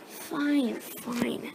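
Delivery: sulky whisper, giving up. Real, not acted. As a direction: mutter it under your breath, defeated. Grudgingly giving up to sister.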